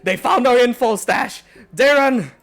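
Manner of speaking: loud, high desperate shout; injured shout. Acted. Injured hobgoblin bursting in shouts for Darren. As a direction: yell for Darren, wounded and urgent. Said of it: "As he shouts out".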